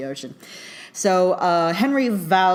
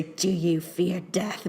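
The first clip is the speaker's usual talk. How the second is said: slow, soft, eerie ghost captain voice; creepy voice; 4 dB quieter, much slower than usual. Retold or imitated. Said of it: "does, like, the creepy voice".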